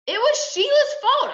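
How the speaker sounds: put-on very high, loud boyish outcry; indignant protest. A narrator's reading. Son defending himself to his mother, indignant. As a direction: protest the unfair blame at top pitch. Boy protests being blamed.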